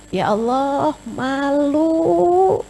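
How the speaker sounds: high, pleading prayer voice; ashamed prayer. Acted. Preacher acting a woman praying to God in shame. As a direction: beg God, ashamed of yourself. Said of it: "Nangis karena Allah tuh".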